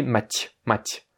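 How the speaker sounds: "Math" as Mathieu cut before the vowel, ending ty; soft t with faint glide in "Math"; Mathieu without eu. Shown as a demonstration. Teacher derives the sound from Mathieu, soft t. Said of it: "tu enlèves le E à la fin".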